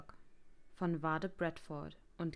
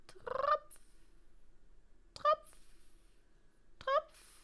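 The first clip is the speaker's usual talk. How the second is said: very high, short plinking drips; dripping water; much slower than usual. A narrator's reading. Narrator imitating a leak over the bed, dripping. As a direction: imitate single drops, high and spaced out.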